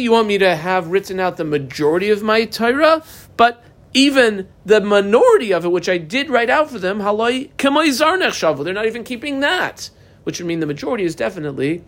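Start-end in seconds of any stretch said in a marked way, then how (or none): 0.0-3.0 "you want me to have written out the majority of my Torah" climbing steadily in disbelief; higher-pitched challenge, lifting into "my Torah"; rhetorical question. Acted. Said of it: "it's a rhetorical question". 3.4-9.9 high-pitched, exasperated reproach; acted divine scolding, pitched well up; rebuking.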